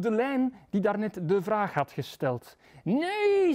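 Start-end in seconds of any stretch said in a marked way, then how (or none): 2.8-3.5 high, drawn-out cry of no; exasperated high-pitched shout. A narrator's reading. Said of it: "schreeuwde Gabriel".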